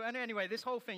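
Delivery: ordinary talk, nothing marked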